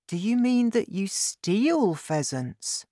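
put-on high, slow child's question; high-pitched childlike disbelief; shocked boy. A narrator's reading. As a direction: ask slowly as a disbelieving child. Boy shocked at his father's secret.

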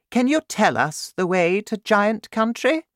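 high, regal voice of the Queen; Queen's voice. Acted. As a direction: speak up high like a worried queen.

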